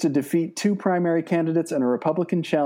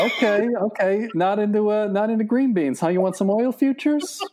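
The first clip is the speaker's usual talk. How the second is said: higher, pushy mock salesman patter; huckster pitch; 6 semitones higher than usual. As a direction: hawk it like a shady salesman.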